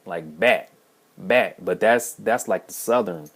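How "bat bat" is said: "bat" repeated with a front a vowel, each one stressed; emphatic, separate "bat", correcting the vowel; corrected pronunciation. Shown as a demonstration. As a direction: say "bat" apart and firmly, vowel as in cat. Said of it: "she was correcting me. She was saying".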